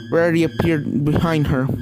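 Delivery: usual speaking voice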